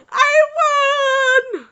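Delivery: very high, loud victory cry; ecstatic shout. Real, not acted. Player getting the top rank, high triumphant cry. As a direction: shout it to the ceiling. Celebrating the win.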